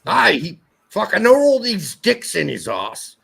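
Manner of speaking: a light, loud mock Scottish accent. Shown as a demonstration. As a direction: toss it off in a rough Scottish brogue. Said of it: "if you were Scottish".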